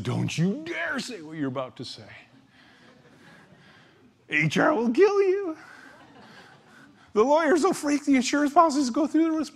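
dark inner voice. Pretend. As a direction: let the fearful voice in your head rise into alarm. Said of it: "The dark voice said".

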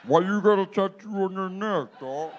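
drawn out, like a slowed rewind. Shown as a demonstration. Replaying the joke for the crowd, slowed down. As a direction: stretch it out as if replaying in slow motion. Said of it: "want me to do it slower?".

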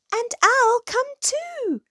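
very high, tiny squeaky voice; high-pitched small-animal voice; little dormouse voice. A narrator's reading. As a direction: pipe up eagerly as a tiny mouse.

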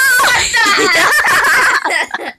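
shrieking laugh. Real, not acted. Loud crying laugh.

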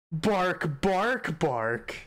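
playful imitated barking; mock dog barks. [name] talking to Barkley, mock dog barks. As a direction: bark like a friendly dog.

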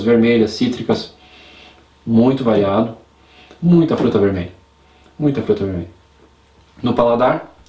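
glass held at lips; talking while nosing glass; speaking into a glass. Real, not acted. Seen 0: Glass raised to nose and lips through most labelled frames.

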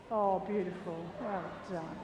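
low, soft, approving murmur; soft praise. Real, not acted. Trainer to dog after the throw, softly praising.

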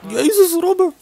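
sudden high yelp of disbelief; high-pitched Jezus Robben; shocked outburst. Real, not acted. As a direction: cry out the name, stunned. Shocked at player's miss.

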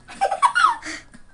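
high-pitched giggle; laughing. Real, not acted. Gamer cracking up at his mate, high giggle. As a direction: burst into a high cackle. Friends joking during game.

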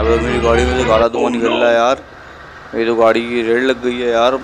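ordinary talk

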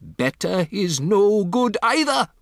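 put-on high, loud accented retort; indignant witch screech; shrieking witch. A narrator's reading. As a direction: snap back, climbing to a shriek. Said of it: "shrieked the Grand High Witch".

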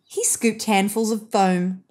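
ordinary delivery

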